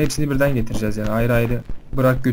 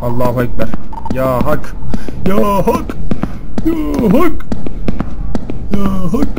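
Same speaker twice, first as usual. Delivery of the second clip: panicked prayer shouts; 7 semitones higher, 10 dB louder, much slower than usual. Real, not acted. Panicked invocations grabbing item.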